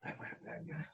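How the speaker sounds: a breathy whispered echo. Real, not acted. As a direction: whisper the question back.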